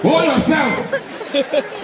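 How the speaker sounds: mock pilot yelling back to the cabin; casual pilot. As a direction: shout a breezy warning to hold on. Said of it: "turned back and said".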